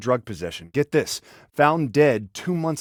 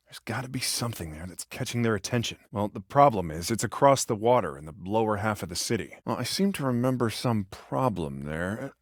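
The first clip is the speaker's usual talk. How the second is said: ordinary talk, nothing marked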